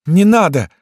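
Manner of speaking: high, loud pained plea; pleading girl. A narrator's reading. Wounded Lyuska asks doctor to stop, pleading. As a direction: beg in a high girlish cry. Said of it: "попросила Люська".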